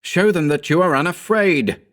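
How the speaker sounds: high, urgent call; crying out. A narrator's reading. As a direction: urge him on. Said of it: "I cried".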